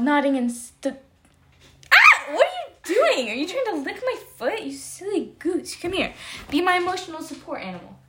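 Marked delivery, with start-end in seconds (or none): 1.9-2.3 sudden high yelp of surprise; real startled squeal. Real, not acted. Dog licks her foot. 2.3-8.0 high, soft cooing at a pet; doting pet talk, higher and softer. Real, not acted. Cooing at her dog.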